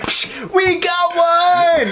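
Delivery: high, drawn-out triumphant shout; Ghostbusters secretary. Acted. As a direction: holler it slowly like an alarm call. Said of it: "like in fucking Ghostbusters when the secretary's like".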